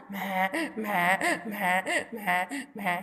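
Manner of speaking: a repeated braying "ma, ma" like a donkey; donkey bray imitation. Shown as a demonstration. Teacher imitates a donkey's bray for the children. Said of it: "this is the sound of donkey".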